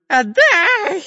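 very high, loud shriek; Dyrka's inhuman scream. A narrator's reading. Spy demands the chest back, inhuman shriek. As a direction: shriek it like a creature possessed. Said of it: "нечеловеческим голосом закричал шпион Дырка".

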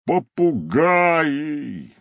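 one word drawn out long and high; sighing rhinoceros. A narrator's reading. Rhinoceros reacts to the cub's parrot, exclaimed sigh. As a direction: stretch it into a big theatrical sigh. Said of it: "вздохнул носорог".

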